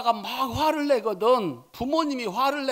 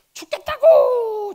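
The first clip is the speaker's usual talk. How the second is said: high, loud wail of misery; anguished cry; 13 semitones higher, 10 dB louder, slower than usual. Acted. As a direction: cry it out like a dying man. Jonah complaining of heat.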